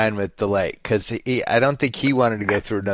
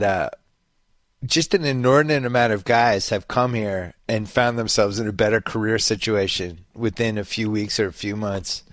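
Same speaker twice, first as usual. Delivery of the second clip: ordinary delivery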